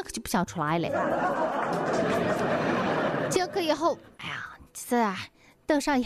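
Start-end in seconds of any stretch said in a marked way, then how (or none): 0.9-3.2 short airy giggle; breathy laugh. Real, not acted. Laugh alone on a line. 4.2-5.1 whisper. Real, not acted. Hesitating in a toilet stall.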